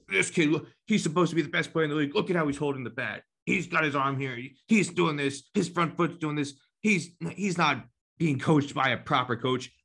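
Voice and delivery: mocking jealous coach. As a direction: sneer like a jealous rival coach. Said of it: "hear them talking about on the sideline".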